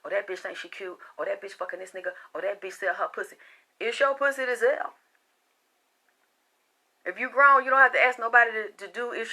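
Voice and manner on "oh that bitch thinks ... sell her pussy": hushed, low mock phone gossip; soft, low retelling of catty rumours; imitated gossip. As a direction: imitate gossips talking low behind someone's back. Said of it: "call around".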